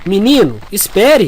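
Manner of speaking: insistent call. Acted. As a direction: call him back, pressing. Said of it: "Insistiu Luciano".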